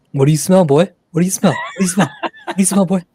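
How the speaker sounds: high, loud, playful dog-talk; excited dog owner. Pretend. Joking on stream as if to a sniffing dog, excited. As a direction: pitch it up and hype the dog, mock eager.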